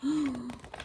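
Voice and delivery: sharp, high storytelling gasp; dramatic gasp. Acted. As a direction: gasp as if shocked. Shocked reaction to the wolf's plan.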